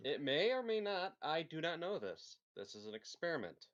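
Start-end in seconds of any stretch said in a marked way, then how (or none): 0.1-2.4 high, loud and slow, deadpan; mock solemn. Player explaining the blender experiment, high, loud, slow mock gravity. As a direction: draw it out with mock seriousness.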